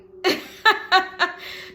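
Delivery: breathy burst of laughter. Real, not acted. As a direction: laugh it out, unvoiced. Laughs at own impression.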